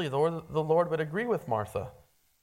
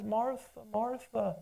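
softer, calm and caring, slowing her down; 3 semitones higher, 4 dB quieter than usual. Retold or imitated. Jesus calmly stopping worried Martha, soft and caring. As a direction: gently settle a panicked friend. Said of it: "he just stops and he calmly says".

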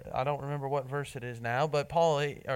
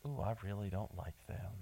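much softer, lower grumble of resentment; quiet resentment; 8 semitones lower, 12 dB quieter than usual. Retold or imitated. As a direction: mutter it, nursing a grudge. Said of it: "it's rather resentment".

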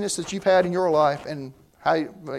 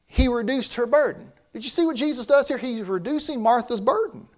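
usual speaking voice

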